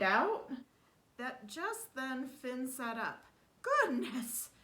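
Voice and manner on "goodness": high, loud startled exclamation; exclaim. Acted. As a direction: gasp it out in shock. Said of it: "exclaimed Cuhullin".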